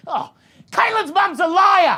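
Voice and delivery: high-pitched accusing cry; hammed-up indignant mum; mock outrage. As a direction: shriek the accusation in mock horror. Mum's outraged denial, acted out.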